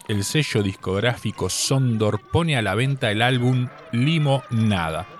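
usual speaking voice

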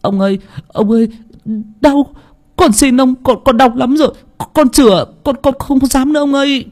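high, wailing beg for mercy; screaming plea. Acted. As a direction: cry out in pain, begging. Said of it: "tiếng hét van xin". (thằng Thổ bị đánh, kêu khóc van xin)

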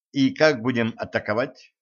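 ordinary delivery

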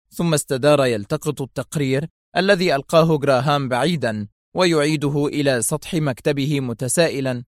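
usual speaking voice

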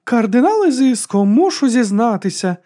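high, slow mock confession; loud, lilting singsong parody; sing-song. A narrator's reading. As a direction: chant it high, mocking a penitent. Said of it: "заспівала Батиста".

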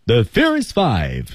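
slow "The Furious Five" on a falling tune. Shown as a demonstration. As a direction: land it firmly downward. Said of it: "整体用降调".

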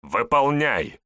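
loud, high barked command; barking order. Acted. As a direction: bark the order. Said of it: "рявкнул хозяин дома".

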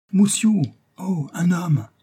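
put-on high-pitched little boy's cry; startled, piping child's exclamation; alarmed child. A narrator's reading. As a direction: call out a warning as a small pupil. Child alerts teacher to intruder.

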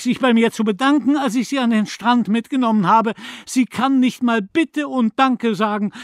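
high, loud furious tirade; mother raging at the neighbour; torrent of rage. A narrator's reading. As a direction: pour out pain and rage without stopping. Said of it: "als ströme in ihr ein Sturzbach aus Wut".

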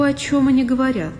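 plain reading voice

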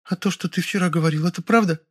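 high, tentative female voice; high-pitched, unsure question; hesitant. A narrator's reading. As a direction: ask shyly in a high voice. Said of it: "замялась она".

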